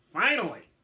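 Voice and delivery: loud, exasperated outburst; finally, at last, burst out; loud impatient villain cry; impatient exclamation. Acted. Impatient villain greets detectives.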